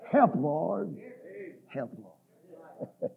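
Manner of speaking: low, hushed cry for help; fading plea. Acted. Preacher echoing the cry for help, low and fading. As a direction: let it sink away to almost nothing.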